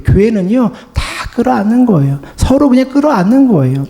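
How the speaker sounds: ordinary delivery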